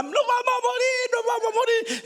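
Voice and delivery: a panicked woman in the back; screaming lady. Retold or imitated. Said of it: "Una señora gritaba atrás".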